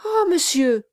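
high, loud anguished cry; cried out. Acted. Bride crying out to the gentleman, distressed. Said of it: "s'écria la fiancée".

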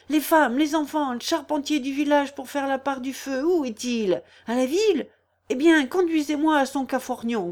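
high, pressing orders; urgent commanding. A narrator's reading. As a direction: take charge of a flustered crowd. Organising villagers at fire alarm.

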